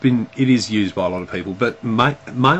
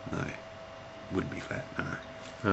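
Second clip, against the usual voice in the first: soft, breathless whisper to herself; half-joking whispered self-correction; whispered aside; 16 dB quieter, slower than usual. Real, not acted. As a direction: drop to a private whisper.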